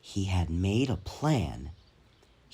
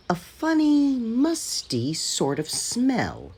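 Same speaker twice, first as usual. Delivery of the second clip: higher, drawn-out puzzled engine voice; Thomas the engine; 12 semitones higher, slower than usual. A narrator's reading. Thomas muses to his coaches, higher and slow. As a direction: savour the word musty slowly. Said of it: "said Thomas".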